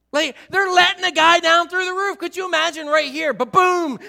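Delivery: high, loud, excited preaching. Real, not acted. Pastor to congregation, high and loud excitement. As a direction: belt it out to the back row.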